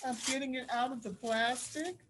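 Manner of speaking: usual speaking voice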